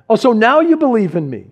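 sarcastic so now you believe; mock surprise. Jesus mocking disciples' sudden faith, sarcastic. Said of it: "it sounds a little bit like this".

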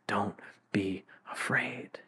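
gentle whisper; whispered reassurance. Acted. Preacher voicing God to the frightened, a soft whisper. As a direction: whisper it like a comfort. God's words repeated softly.

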